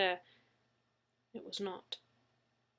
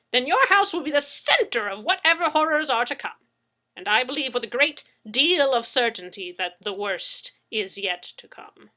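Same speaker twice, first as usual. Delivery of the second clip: put-on higher, louder ominous old eccentric; Mad Zeke voice; 4 semitones higher, 10 dB louder than usual. A narrator's reading. Mad Zeke warns Leslie of coming horrors, loud. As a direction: warn them gravely but with flair.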